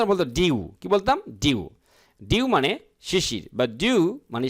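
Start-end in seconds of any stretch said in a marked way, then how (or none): 0.3-0.7 ডিউ as "dyoo", British style; British pronunciation. Shown as a demonstration. Teacher contrasts with American, British "dyoo" for ডিউ. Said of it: "British উচ্চারণ হতো". 1.3-1.7 ডিউ as "dyoo", British style; British pronunciation. Shown as a demonstration. Teacher answers own question, British "dyoo" for ডিউ. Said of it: "British উচ্চারণ হতো".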